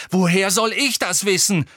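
loud, high, exasperated outburst; angry bellow. A narrator's reading. Harry snaps back at Hermine, bellowing. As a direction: throw the question back in a temper. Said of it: "brüllte Harry".

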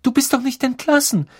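put-on high-pitched girl's voice, aghast; alarmed cry. A narrator's reading. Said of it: "rief Hermine und hielt den Atem an".

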